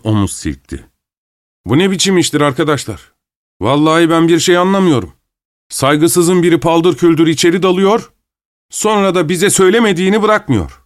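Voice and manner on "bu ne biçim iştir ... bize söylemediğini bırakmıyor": indignant banker. A narrator's reading. Banker protesting the intrusion.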